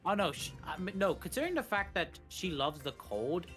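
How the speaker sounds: usual speaking voice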